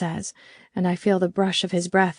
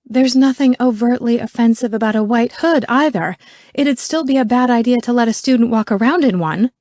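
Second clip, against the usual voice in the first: loud, higher indignant retort; volleying back; 5 semitones higher, 6 dB louder than usual. A narrator's reading. Merkel throws Dorfman's words back at him, indignant. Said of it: "Merkel volleys back".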